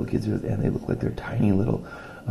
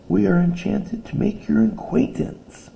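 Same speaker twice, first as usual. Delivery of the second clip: high, louder excited little voice; breathless excitement; 6 dB louder, slower than usual. Acted. Tiny Booker greeting the librarian, high breathless excitement. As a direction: gush it out, tiny and thrilled. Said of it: "exclaimed the breathless voice".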